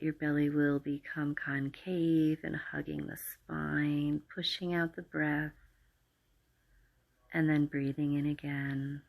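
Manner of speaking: calm, unhurried meditation voice with long pauses; guided meditation. Acted. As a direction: guide the listener gently into relaxation. Leads a guided relaxation meditation.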